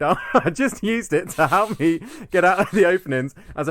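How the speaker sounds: reading on, tickled by the stumble; laughing read-aloud. Real, not acted. Rereads after laughing at a stumble.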